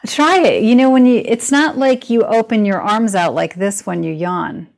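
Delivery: usual speaking voice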